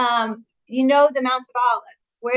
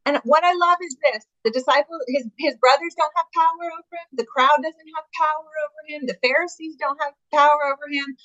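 normal talking voice

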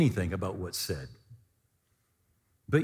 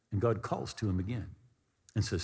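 ordinary delivery

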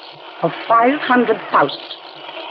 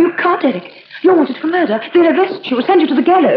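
frightened pleading; 10 semitones higher than usual. Acted. Wife begs him not to go.

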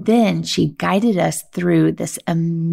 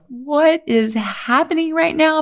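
much higher, stunned voice of wonder; startled high wonder; amazed; 10 semitones higher than usual. Retold or imitated. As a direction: gasp it out in disbelief. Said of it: "my body was like".

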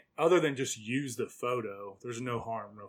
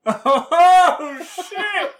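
shocked laughing; 12 dB louder than usual. Real, not acted. Shocked laugh at aged photo.

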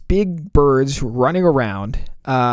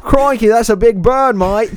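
higher, louder mock Australian accent; horrible Australian accent; 7 semitones higher, 5 dB louder than usual. Host joking about the huge bird, hammed-up Australian accent. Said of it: "we have horrible Australian accents".

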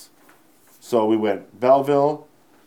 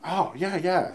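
high, bright eager agreement; eager yes; 8 semitones higher, slower than usual. Retold or imitated. To friends suggesting the tour, eager high yes. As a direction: jump at the idea, pitched up.

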